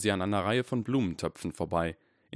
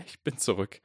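trembling voice; 2 semitones higher than usual. A narrator's reading. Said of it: "sagte Harry mit zitternder Stimme".

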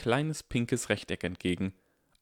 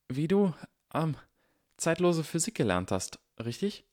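hesitant Hermine; 9 semitones higher than usual. Acted.

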